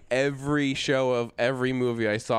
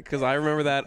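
normal talking voice

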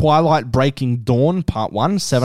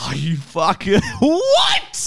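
outraged disbelief; 7 semitones higher than usual. Real, not acted. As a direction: yell it in total disbelief. Outrage at friend's Transformers pick.

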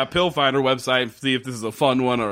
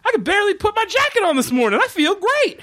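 very high, mock-euphoric gushing; elated gratitude. Man thanks her for the painkiller, hammed-up elation. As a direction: gush in an excited, high imitation. Acting grateful man after pill works.

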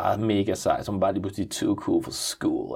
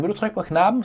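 put-on child's question; 11 semitones higher than usual. Father voicing son on bus.

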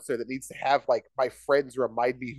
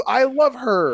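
mock plea; 9 semitones higher, 7 dB louder, slower than usual. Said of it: "slightly lower voice".

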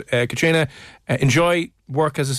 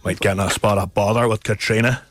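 lightly put-on Antrim accent, a little lower; mock Antrim accent; 5 semitones lower than usual. Host teasing a caller from Antrim, mock Antrim accent. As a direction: tease with a Northern Irish Antrim twang. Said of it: "do your best Antrim accent".

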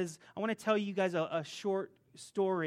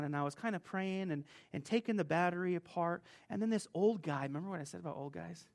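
ordinary talk

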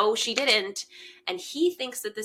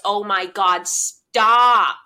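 loud exclamation; 11 dB louder, much slower than usual. Real, not acted. Reacting to the kiss.

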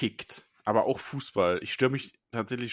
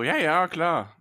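put-on higher, louder brush-off teen yes; dismissive teenager; 7 semitones higher, 6 dB louder, much slower than usual. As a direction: wave it off like a teen. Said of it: "dieses jugendliche so".

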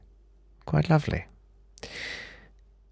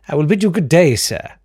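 haughty farewell; 8 semitones higher, 4 dB louder than usual. Acted. Narrator dismissing barkeep.

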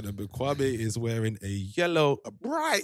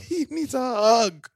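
higher and louder, with emphasis; 9 semitones higher, 5 dB louder than usual. Retold or imitated. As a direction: announce it so the room hears. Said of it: "And I said".

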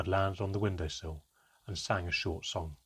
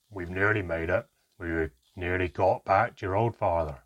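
gruff comfort; 3 semitones lower, 5 dB louder than usual. Acted. Handelm hugging the crying child, gruff and comforting. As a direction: lower gruff reassurance.

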